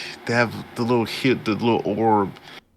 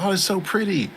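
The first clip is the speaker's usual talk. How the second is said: imitated wonder, pitched way up; mock admiring onlookers; 10 semitones higher, faster than usual. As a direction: coo it like an awed onlooker. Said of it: "they're like".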